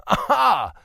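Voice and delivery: sudden loud, high aha; triumphant exclamation; eureka shout. Acted. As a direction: burst out with discovery. Brother suddenly sees the point.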